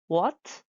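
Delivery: WHAT lifted like a question; rising tone. Shown as a demonstration. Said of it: "it's definitely a rise tone".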